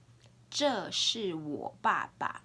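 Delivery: slow, deliberate Mandarin for learners; teacher's drawn-out demonstration; slow language model. Shown as a demonstration. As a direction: model each word slowly and distinctly. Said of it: "say it three times for you to hear".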